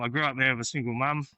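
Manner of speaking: normal talking voice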